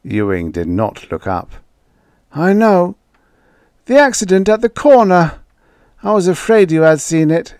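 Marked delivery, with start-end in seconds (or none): 2.3-7.5 put-on high, loud faltering old voice; an old man stumbling over his doubts; hesitant old man. A narrator's reading. Ewing faltering to Joey, high old man's voice.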